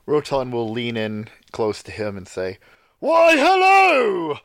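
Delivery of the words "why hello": sudden loud, slow, high "Why, hello!" right in his ear; loud greeting. Acted. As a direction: boom a cheerful hello to startle him awake. Leaning in close to wake sleeper.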